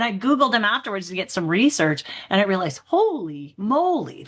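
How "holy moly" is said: slow, drawn-out holy moly; mock astonished gasp of words; retold amazement. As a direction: marvel at it, stretching the words. Realizing he said yes.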